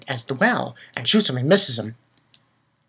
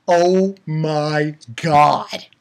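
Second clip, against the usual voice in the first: awed disbelief; much slower than usual. Retold or imitated. Reenacts own awed reaction.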